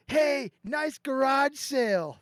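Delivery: mock yell. Friend heckling a fallen skier from the ski lift, mock yell. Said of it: "he yells out at the guy".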